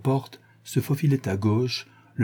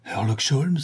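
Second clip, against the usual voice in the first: lightly choked voice, pitched up; strangled, cavernous voice; 4 semitones higher, slower than usual. A narrator's reading. Pinned friend recognises his attacker, strangled stammer. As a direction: stammer it out through a squeezed throat. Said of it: "balbutia une voix étranglée, caverneuse".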